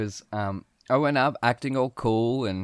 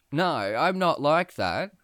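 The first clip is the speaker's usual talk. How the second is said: usual speaking voice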